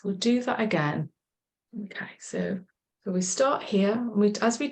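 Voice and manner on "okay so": hushed whisper. Real, not acted. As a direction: drop to a soft whisper. Hushed aside during breathing exercise.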